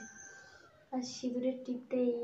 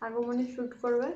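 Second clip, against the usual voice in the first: speaking between bites; slightly muffled chewing speech; mouth full, chewing. Real, not acted. Seen 1: Eats rice at -2, then jaw works with food in mouth.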